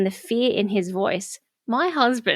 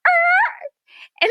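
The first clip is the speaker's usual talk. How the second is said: imitated screaming; 4 dB louder than usual. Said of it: "it was like".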